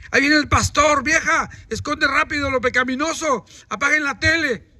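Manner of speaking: aviso apurado en tono más agudo; alarma fingida. Acted. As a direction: imita a quien avisa con urgencia en casa. Voz de familia escondiendo el pecado. (alarma fingida, con voz más aguda)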